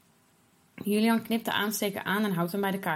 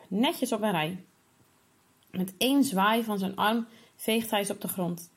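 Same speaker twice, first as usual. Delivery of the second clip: ordinary delivery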